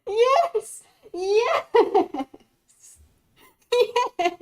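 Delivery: squealing high yes after yes; thrilled cheering. Real, not acted. Streamer cheering a debate comeback, squealing with delight.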